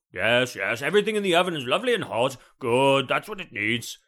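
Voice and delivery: a hearty know-it-all pronouncing judgement; self-satisfied expert. Acted. Sean praising his own cooking aloud, hearty and pompous. Sean answering himself at the oven.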